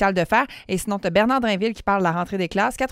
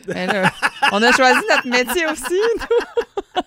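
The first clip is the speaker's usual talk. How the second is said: laughing; 6 semitones higher, 4 dB louder, slower than usual. Real, not acted. Rire en taquinant.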